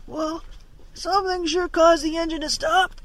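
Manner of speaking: high, strained voice from below deck; muffled reply. A narrator's reading. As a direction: answer as if shouting up from inside the hull. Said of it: "came the muffled reply".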